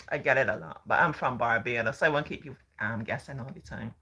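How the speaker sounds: usual speaking voice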